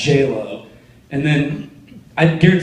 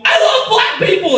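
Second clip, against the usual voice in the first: very high, hammed-up panic; panicked yell; 3 dB louder than usual. Driver fleeing a gunman, high mock-panicked yell. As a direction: blurt it out in mock terror. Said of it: "panic and I go".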